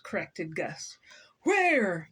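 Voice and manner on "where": loud, higher one-word demand; sharp raised call; storybook yell. A narrator's reading. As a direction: yell it out as the cross man. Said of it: "yelled Mister Frizzle".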